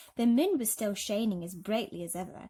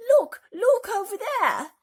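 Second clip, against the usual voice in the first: loud, much higher, slow cry; shouting; 13 semitones higher, 12 dB louder than usual. A narrator's reading. Ladybird shouting to everyone to look ahead. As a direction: point and shout across the sky. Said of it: "shouted the ladybird".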